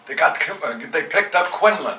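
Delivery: sudden excited rush. Real, not acted. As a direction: tell it like the news just arrived. News of the missing pilot.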